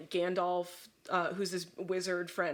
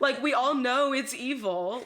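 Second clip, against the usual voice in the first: louder, higher, drawn-out mock scolding; exasperated warning; 5 semitones higher, 6 dB louder, slower than usual. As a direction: insist on the obvious, slowly. Acts out Gandalf warning Bilbo.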